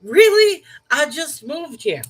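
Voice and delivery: high, loud, drawn-out incredulous question; amused disbelief. Retold or imitated. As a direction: react as if it's laughably absurd. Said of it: "I almost laugh at this".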